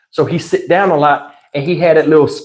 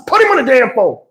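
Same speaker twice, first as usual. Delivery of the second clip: mock angry shout, higher; angry demand; 7 semitones higher, faster than usual. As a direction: bark the order down the phone. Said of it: "I'm starting to get mad".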